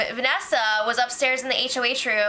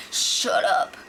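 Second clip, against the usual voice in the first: ordinary talk, nothing marked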